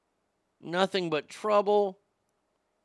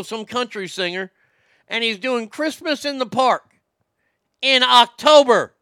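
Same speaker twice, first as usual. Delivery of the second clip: incredulous rant; 6 semitones higher, 5 dB louder than usual. Real, not acted. Ranting about radio prizes.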